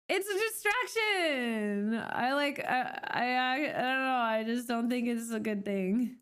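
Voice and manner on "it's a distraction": pitched up and slowed, mock-dramatic; mock-serious singsong; high drawn-out repeat. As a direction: stretch out distraction up high.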